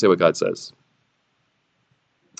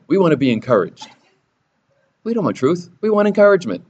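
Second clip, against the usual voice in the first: mocking whine; 9 semitones higher, 3 dB louder, faster than usual. Preacher's mocking congregation voice.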